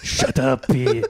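usual speaking voice

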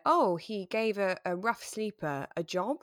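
ordinary delivery

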